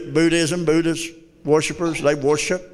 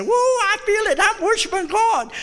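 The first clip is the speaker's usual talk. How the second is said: much higher, hammed-up ecstatic whoop; mock frenzied worship; 12 semitones higher than usual. Preacher mocking worshippers who work themselves into a frenzy, hammed-up ecstatic cry. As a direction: imitate someone worked up and sweating in worship. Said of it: "work yourself up in a frenzy and say".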